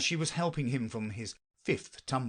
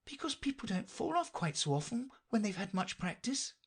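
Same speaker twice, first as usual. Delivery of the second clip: high-pitched, polite reasoner; Alice; 12 semitones higher than usual. Acted. Alice explains her doubt to the knight, high and polite. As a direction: explain gently in a raised voice.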